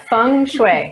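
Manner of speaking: Feng Shui said slowly as "fung shway"; confirming the pronunciation. Shown as a demonstration. Guest confirms it for the host, Feng Shui slowly as "fung shway". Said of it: "Yep, you've got".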